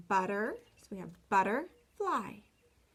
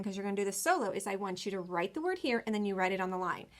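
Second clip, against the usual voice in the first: ordinary delivery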